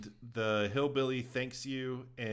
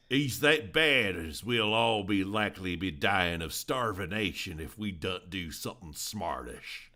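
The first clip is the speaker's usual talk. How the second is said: a light Southern hillbilly accent, a bit louder; 4 semitones lower, 3 dB louder than usual. Shown as a demonstration. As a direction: read it like a hillbilly farmer. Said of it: "you read it as if it's a hillbilly".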